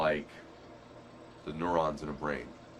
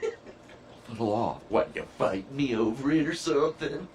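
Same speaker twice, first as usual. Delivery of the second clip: mock tough guy; 7 semitones higher, 4 dB louder than usual. Playful tough-guy challenge.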